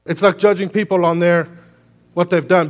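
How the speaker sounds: loud, high preacher's cry; preaching at full force. Acted. Pastor pressing the point on the church, loud preaching. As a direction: drive it home from the pulpit.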